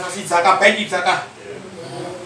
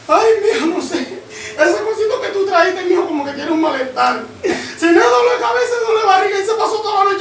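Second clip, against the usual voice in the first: put-on wailing old mother; 9 semitones higher than usual. Old mother tells her son about the phone, high wailing. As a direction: cry out the complaint like a distraught old mother.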